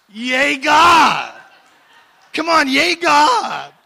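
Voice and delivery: high, very loud shout of praise; rally the crowd with a whooping cheer; jubilant cheer. Real, not acted. Preacher urging congregation to celebrate God, jubilant shout.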